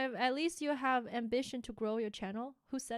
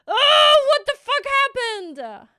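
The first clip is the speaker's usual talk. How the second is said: panicked yell; 12 semitones higher, 17 dB louder, slower than usual. Real, not acted. Something broke on stream.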